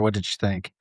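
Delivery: usual speaking voice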